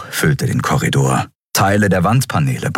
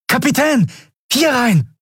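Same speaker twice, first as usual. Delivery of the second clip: high urgent call across the corridor. A narrator's reading. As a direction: call the captain over, urgent. Boy calling from a side door.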